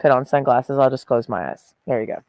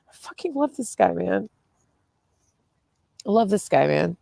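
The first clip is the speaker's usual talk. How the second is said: choked up; 3 semitones higher, 5 dB quieter than usual. Real, not acted. Emotional about rescued cat.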